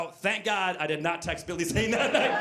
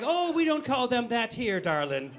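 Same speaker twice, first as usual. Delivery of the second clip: higher-voiced pub landlady, gently correcting; little old Irish barkeeper; 5 semitones higher than usual. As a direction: play the old barwoman setting a customer straight. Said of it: "little old lady who runs the bar".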